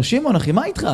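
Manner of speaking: high friendly call; upbeat greeting called out. Retold or imitated. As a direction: hail a friend who ignores you. Calling friend across street.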